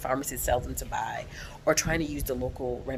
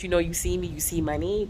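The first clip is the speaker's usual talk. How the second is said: ordinary delivery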